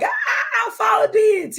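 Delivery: high-pitched squeal of discovery; triumphant cry. Retold or imitated. Telling of finding the underground market, elated high cry. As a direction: cry out like you've struck gold, drawn out.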